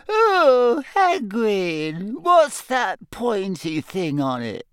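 nervous pupil pointing at a sting; alarmed girl. Acted. Lavender frightened by sting.

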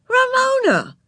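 high, loud horrified cry. A narrator's reading. Big sister scolding Ramona, horrified and high. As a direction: gasp her name in shock. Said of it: "Beezus was horrified".

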